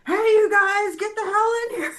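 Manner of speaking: high, loud mock yell out a window; mock screaming. Bandmate mocks his friend yelling out a window, high and loud. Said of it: "he's screaming out the window".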